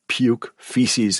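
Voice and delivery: ordinary delivery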